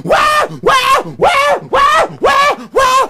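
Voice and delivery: very high, loud rhythmic wails; repeated yelling. A narrator's reading. Anansi beaten by the whipping cord, yelling. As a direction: yelp again and again in comic agony. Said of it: "Anansi was yelling".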